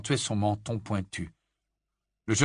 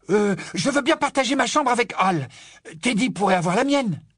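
put-on high loud excited boy voice; eager boy; 12 semitones higher, 5 dB louder than usual. A narrator's reading. Boy offering his room, high loud eagerness. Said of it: "avec enthousiasme".

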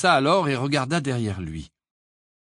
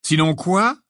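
louder, higher mocking challenge; malicious taunt; 8 semitones higher, 6 dB louder, slower than usual. A narrator's reading. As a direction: goad him with a nasty grin. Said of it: "un sourire malveillant".

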